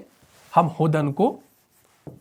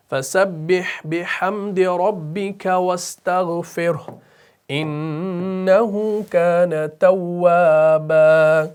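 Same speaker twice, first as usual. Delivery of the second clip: slow, chanted tilawat; slow measured Quranic chant; Quran recitation; slower than usual. Shown as a demonstration. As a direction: recite melodically and slowly. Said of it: "recites verse as example".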